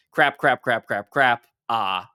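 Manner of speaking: loud string of crap, crap; repeated curses. Retold or imitated. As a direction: spit out the curses in rising panic. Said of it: "Cecil says his first crap".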